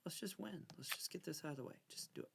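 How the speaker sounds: normal talking voice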